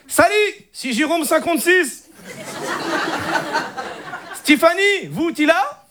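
hammed-up Moroccan cousin chatting up a girl; Moroccan Arabic accent. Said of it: "l'accent arabe".

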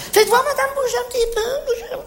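high, gushing encouragement; coaxing, excited. Acted. Salesman urging a customer to move around, high and gushing. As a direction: urge her to move, almost squealing.

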